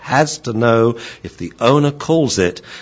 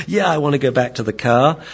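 higher-pitched voice of a keen dog; eager dog; 7 semitones higher than usual. Acted. Dog eager to go back to the car, higher keen voice. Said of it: "And so the dog says".

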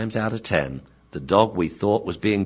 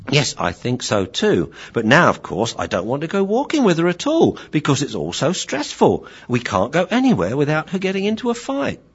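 higher, fretful complaint, rising; stressed young dog; 8 semitones higher than usual. Acted. Dog not wanting walks with owner, fretful high voice. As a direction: grumble anxiously to the master. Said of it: "so really upsetting".

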